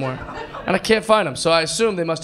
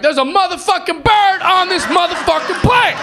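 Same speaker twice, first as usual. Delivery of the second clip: much higher, yelled mock outrage; outraged shout; 12 semitones higher, 5 dB louder than usual. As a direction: bellow it at the whole plane.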